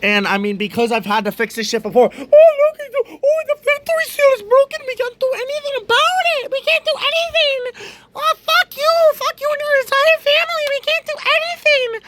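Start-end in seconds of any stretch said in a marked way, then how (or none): 2.1-12.0 high, fast, snotty service-desk voice; hammed-up dismissive clerk; mock repair clerk. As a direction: sneer like a smug technician refusing help. Mocking the repair shop.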